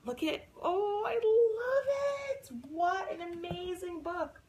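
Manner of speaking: high, emotional gushing; teary delight. Real, not acted. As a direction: gush as if moved nearly to tears. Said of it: "I'm getting teary".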